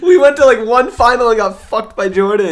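high, loud exasperated fan; heated, half-laughing outburst; indignant protest. Real, not acted. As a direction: argue it loudly, pitch way up. Indignant fan recounting team's failure.

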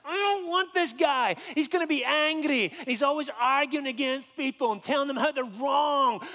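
loud, high-pitched comic dismay; mock horrified protest. Student dreading the angry volunteer, hammed-up dismay. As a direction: open on a long wailed no, then plead.

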